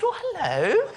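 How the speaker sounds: high, rising "oh, hello"; imitated passenger's greeting. Acted passenger answering the greeting.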